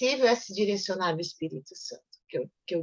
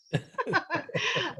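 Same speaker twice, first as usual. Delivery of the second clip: soft laugh; 6 semitones higher, 5 dB quieter than usual. Real, not acted. Laughs at friend's remark.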